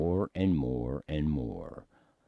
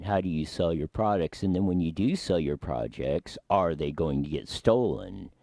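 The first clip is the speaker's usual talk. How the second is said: ordinary talk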